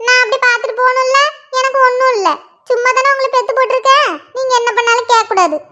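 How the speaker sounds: lightly voiced nagging mother; mother scolding. Mother finds her daughters sitting idle, nagging. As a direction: grumble at the lazy girls like their mum.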